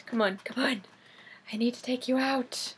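hushed, under-the-breath urging; coaxing whisper. Real, not acted. Collector tugs figure from its box, coaxing whisper. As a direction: softly coax it along.

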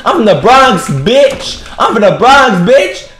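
high, loud put-on Bronx voice; mock Bronx trash talk. Gamer taunting an opponent in a high mock Bronx voice. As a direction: squeal the insults like a tough Bronx girl.

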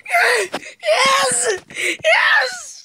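high, jubilant yell; triumphant cry. Real, not acted. Player finally finishes after hours, triumphant high cry. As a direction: celebrate with high-pitched relief.

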